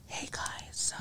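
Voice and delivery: very soft whispered greeting; playful mock ASMR intro. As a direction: whisper close to the mic. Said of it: "trying to test out her A S M R".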